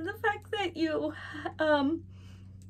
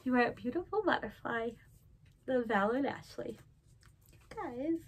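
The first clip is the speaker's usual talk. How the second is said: ordinary talk, nothing marked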